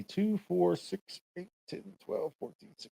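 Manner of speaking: counting through voice effects; filtered, processed voice; sound effect filter. Shown as a demonstration. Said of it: "sixteen pre-programmed sound effects".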